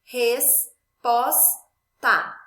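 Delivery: res, pós, tá, cada uma mais alta e isolada; sílabas separadas. Shown as a demonstration. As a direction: bata cada sílaba com pausa. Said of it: "Por exemplo". (soletrando sílaba por sílaba, mais alto)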